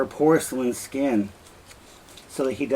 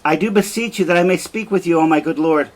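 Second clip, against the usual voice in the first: urgent pleading; 5 semitones higher, 6 dB louder, faster than usual. Acted. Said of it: "And Emilia says".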